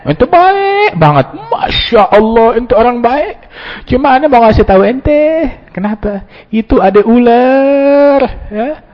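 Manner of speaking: high, slow, syrupy praise; butter him up before the bad news; sugary flattery. False friend flattering before mentioning the snake, syrupy slow voice.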